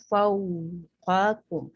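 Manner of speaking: फौकाकुम drawn out very slowly, lips rounded on फौ; slow recitation demo. Shown as a demonstration. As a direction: recite फौकाकुम slowly, sitting on each sukun. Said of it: "इसको अच्छी तरीके से".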